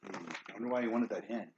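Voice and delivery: ordinary delivery, nothing marked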